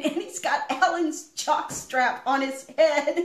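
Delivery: ordinary talk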